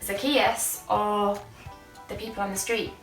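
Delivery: ordinary talk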